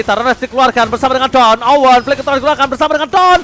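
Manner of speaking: usual speaking voice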